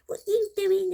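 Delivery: high, loud playful tease; hammed-up teasing grandfather; joking granddad. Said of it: "he'll go".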